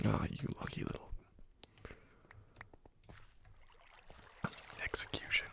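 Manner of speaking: soft, hushed pleased remark; quiet whispered satisfaction; whispered gloat. Real, not acted. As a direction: murmur it under your breath after the hit. After a lucky kill shot.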